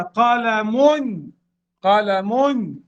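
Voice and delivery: قال من drawn out slowly; slow demonstration. Shown as a demonstration. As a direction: stretch out قال من for the students. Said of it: "نطقتها بلساني". (نطق قال من ببطء للتوضيح)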